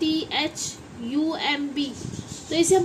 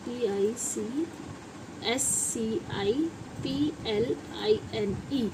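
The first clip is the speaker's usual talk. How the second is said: slow, low, soft letter-by-letter spelling; 4 semitones lower, 7 dB quieter, much slower than usual. Shown as a demonstration. Teacher spelling a word as it is written, slowly spelled out. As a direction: spell slowly under your usual level, pausing.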